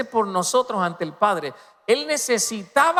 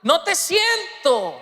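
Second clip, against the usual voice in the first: anguished cry, pitched very high; impassioned acted shout from the pulpit; Jesús; 6 semitones higher, 3 dB louder than usual. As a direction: cry out as if abandoned, high and drawn out. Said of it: "Por eso gritó".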